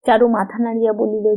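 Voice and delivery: ordinary delivery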